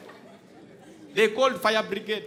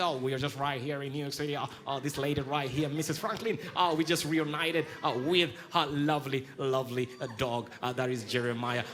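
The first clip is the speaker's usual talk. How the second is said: lower, softer mock TV reporter; mock American reporter; 7 semitones lower, 7 dB quieter than usual. New York reporter on the reunited dog, a lower gushing mock voice. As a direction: play a gushing New York news anchor.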